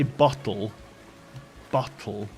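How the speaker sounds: "bottle" with a glottal stop, no T; "bottle" without T. Shown as a demonstration. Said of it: "but there's no T".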